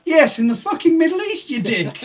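very high laughing exasperation; exasperated shout. Retold or imitated. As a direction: blurt it out in disbelief. Scolding the worker.

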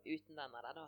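usual speaking voice

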